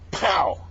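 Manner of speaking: high, loud vocal gunshot; rifle shot. Acted. Joke teller imitates Henry's rifle firing, explosive shot. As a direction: punch out a sudden bang.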